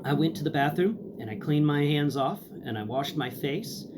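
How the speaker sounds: usual speaking voice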